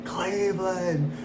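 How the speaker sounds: slow mock-tearful victory cry; imitated crying. Champion to his city after winning, mock crying. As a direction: imitate a champion choked up with tears. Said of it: "And he was crying".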